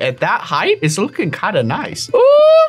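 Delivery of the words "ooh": excited ooh. Real, not acted. Admiring the rocket build.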